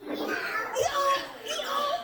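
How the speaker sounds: very high, soft donkey imitation; playful high-pitched hee-haw; donkey bray. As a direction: bray softly in falsetto for children. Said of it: "Can you make donkey sound?".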